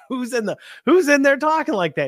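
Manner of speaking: high, laughing mock alarm; mock astonishment. Repeating the question to his sister, in laughing mock astonishment. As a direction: squeal it out in amused disbelief. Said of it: "who's in your house talking like that".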